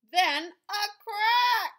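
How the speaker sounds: high, loud, drawn-out gasp of a line; slow and big, the egg breaks; dramatic crack. A narrator's reading. Reading to a child, the big crack reveal.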